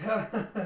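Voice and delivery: loud, high acted laugh; mock laugh. Jim laughing after his man hits a trap, mock laugh. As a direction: laugh it off callously.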